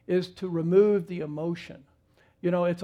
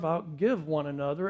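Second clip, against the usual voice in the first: normal talking voice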